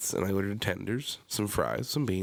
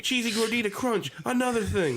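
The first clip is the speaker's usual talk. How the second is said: high, excited order rattled off; rapid-fire order; 13 semitones higher, 4 dB louder than usual. Retold or imitated. Ordering fast at the Taco Bell drive-thru, excited. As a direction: fire the items at them high and eager. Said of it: "I'm like".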